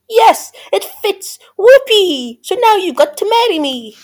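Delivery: high, loud, triumphant squeal; gleeful scream. A narrator's reading. Ugly sister claiming the prince, screaming high. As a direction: squeal it out in victory. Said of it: "But she screamed".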